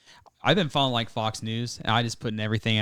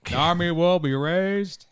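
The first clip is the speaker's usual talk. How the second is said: slow, higher-pitched movie villain impression; Bane; 6 semitones higher, 3 dB louder, much slower than usual. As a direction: draw it out like a grand villain's proclamation. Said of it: "think of Bane's speech in The Dark Knight".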